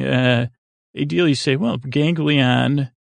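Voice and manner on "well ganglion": usual speaking voice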